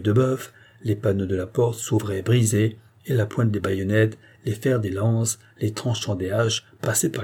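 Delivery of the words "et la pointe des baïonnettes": ordinary delivery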